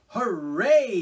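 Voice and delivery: loud, high hooray; joyful cry. A narrator's reading. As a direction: burst out cheering. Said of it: "he cried".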